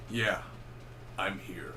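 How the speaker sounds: low, understated movie-hero reply; Mel Gibson. Retold or imitated. Reviewer imitating Max answering the radio call, low understated voice. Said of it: "It sounds like Mel Gibson".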